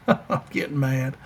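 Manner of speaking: chuckling through it; laughing. Real, not acted. Host laughs at forgetting a player's name, laughing. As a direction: laugh at your own frustration.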